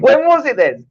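very high-pitched challenge; defensive protest. Real, not acted. Co-host teased over his date demands the answer, defensive. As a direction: throw the question back, pitch shooting up.

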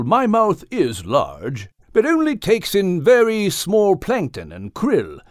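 put-on courteous old-boy character with swooping pitch; persuasive, reassuring whale. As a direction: reassure a nervous passenger with grand, lilting courtesy. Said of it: "doing his best to convince Al".

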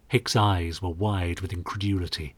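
ordinary delivery, nothing marked

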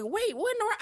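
high-pitched retold astonishment; surprised coworker. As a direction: imitate a coworker's startled double take. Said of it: "why you acting surprised".

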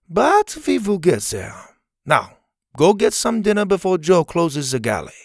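gentle but firm send-off; lightly voiced Helga. A narrator's reading. Helga sending Zeta to dinner, lightly voiced.

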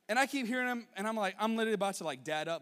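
telling it all fired up, fast; worked-up retelling. Real, not acted. Rising anger at playground.

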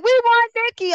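high, loud crowd chant imitation; mimicked screamer at a rally; screaming supporters. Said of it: "the fucking screamers at the fucking press conference".

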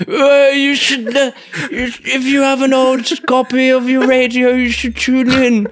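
put-on comic high-pitched old person; put-on old podcaster. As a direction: drag it out like a rambling old-timer. Old podcaster urging someone to listen.

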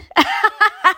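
high giggling laugh. Real, not acted. Host amused by her own German, high laugh.

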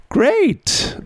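bright, high burst of praise; excited praise. Real, not acted. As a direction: cheer the learner on. Cheerful praise after exercise.

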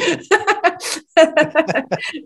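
high, long burst of laughter. Real, not acted. Laughing at her own fussing over God's lesson.

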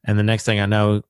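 ordinary talk, nothing marked

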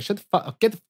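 whiny grumbling at Jocko; a touch of a petulant grump; annoyed self. Retold or imitated. Mock quarrel with Jocko.

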